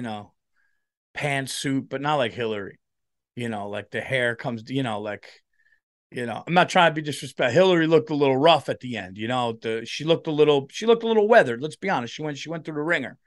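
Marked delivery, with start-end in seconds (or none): none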